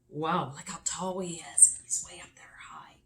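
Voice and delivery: fast unvoiced whisper; whispered awe at the tall snowman; awed whisper. A narrator's reading. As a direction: breathe it in wonder.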